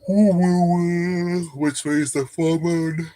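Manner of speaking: words dragged slowly through a yawn; slow, stretched, yawny words. Real, not acted. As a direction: yawn mid-sentence. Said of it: "excuse me".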